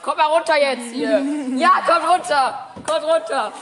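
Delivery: loud calling out. Real, not acted. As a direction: call them down loudly from afar. Calling kids down from slide.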